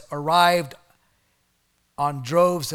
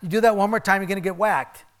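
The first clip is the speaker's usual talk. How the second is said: louder, a bit higher threat; mock stern parent; stern parental warning; 4 semitones higher, 5 dB louder, faster than usual. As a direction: warn like a no-nonsense parent. Voicing a firm parent or God.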